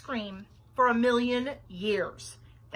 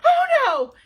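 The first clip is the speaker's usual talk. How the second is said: high, very loud shriek of alarm; 17 dB louder than usual. A narrator's reading. Babysitter hearing the girls scream, shrieking. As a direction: shriek it in horror. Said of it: "Nancy shrieked".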